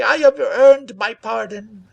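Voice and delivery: high, loud, slow hoarse plea; hoarse cry. A narrator's reading. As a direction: beg hoarsely for mercy. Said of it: "he cried hoarsely".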